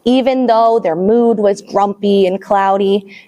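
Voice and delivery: ordinary talk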